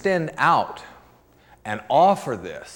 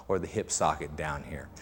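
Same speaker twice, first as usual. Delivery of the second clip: whispered aside; 10 semitones lower, 6 dB quieter than usual. Real, not acted. Aside while demonstrating.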